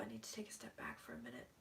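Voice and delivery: fast, hushed whisper to herself; quick whisper. Real, not acted. Overwhelmed psychic whispers she needs a break. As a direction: mutter it under your breath.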